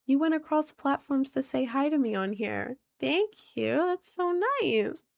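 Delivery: grateful gush. Retold or imitated. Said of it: "It's like".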